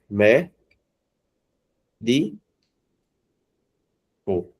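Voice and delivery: "mé, di, co" with long pauses between syllables. Shown as a demonstration. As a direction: split it slowly into "mé, di, co". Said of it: "veja que não é a sílaba". ("mé, di, co" separado sílaba por sílaba)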